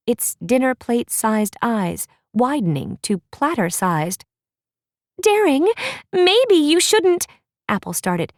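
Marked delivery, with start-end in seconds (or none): 5.2-7.3 Apple's worried call. Acted. Apple voiced, calling after Daring.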